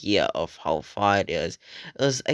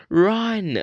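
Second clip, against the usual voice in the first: high shout; 10 semitones higher than usual. Retold or imitated. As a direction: bark it out in a panic. Friend yelling to flee.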